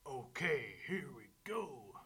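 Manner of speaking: loud, eager storybook wolf; wolf squaring up to blow. A narrator's reading. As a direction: announce it loudly as the wolf.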